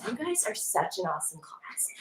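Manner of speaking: soft unvoiced whisper of praise. Teacher whispering praise to her class. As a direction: breathe the compliment to the class.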